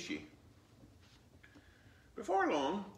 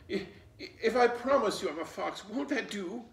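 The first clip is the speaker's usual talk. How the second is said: crying; 9 semitones higher, 6 dB louder than usual. A narrator's reading. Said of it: "Fox was crying like a natural-born baby".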